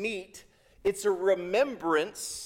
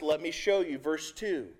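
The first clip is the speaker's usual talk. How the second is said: usual speaking voice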